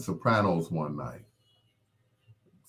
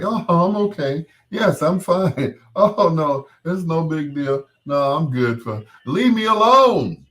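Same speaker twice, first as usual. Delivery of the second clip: high, loud mock brave face; Florida Evans; 10 semitones higher, 7 dB louder than usual. Grieving woman insisting she's fine, high and loud. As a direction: play the Florida Evans denial, pitched way up. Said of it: "what I call the Florida Evans".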